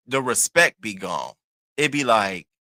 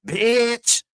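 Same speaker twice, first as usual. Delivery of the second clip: imitated cussing; 11 semitones higher, 5 dB louder than usual. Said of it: "he don't even say it right".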